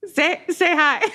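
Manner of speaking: words bubbling with laughter; laughing. Real, not acted. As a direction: giggle through it. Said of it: "I'm a little bit laughing".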